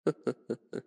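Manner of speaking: low, knowing villain chuckle; short, sinister laugh in the throat; dark chuckle. Acted. Villain amused about the glass.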